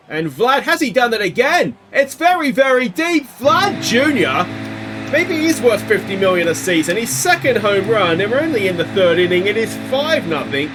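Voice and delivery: high, loud, building disbelief then triumph; thrilled sportscaster in full cry; excited home run call. Real, not acted. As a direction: shout the homer call as it climbs. Commentator calls second home run.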